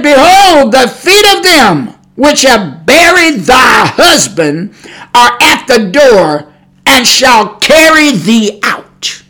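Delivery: loud and slow, like a prophet pronouncing doom; booming slow pulpit reading of Peter's words; dramatic proclamation. Acted. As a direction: thunder out the scripture verdict. Preacher dramatically voices Peter's judgment.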